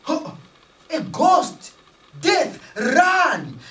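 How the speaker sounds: scared villagers screaming at a ghost; scream of fright. Retold or imitated. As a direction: villagers shrieking in terror. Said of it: "they screamed".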